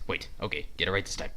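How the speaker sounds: normal talking voice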